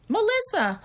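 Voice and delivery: sharp high call of a name. Retold or imitated. Cousin caught lying, calling out her real name loudly.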